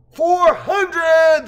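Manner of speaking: high, loud chanting shout; crowd scream. Acted. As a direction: roar it like a crowd. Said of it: "the crowd screamed".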